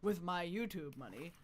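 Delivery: ordinary talk, nothing marked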